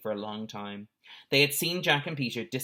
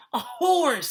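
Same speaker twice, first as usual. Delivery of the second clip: feeble surprise; 4 dB louder than usual. A narrator's reading. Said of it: "said Jack feebly".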